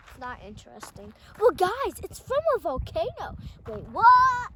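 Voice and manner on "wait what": loud fake shock; hammy wait what; mock surprise.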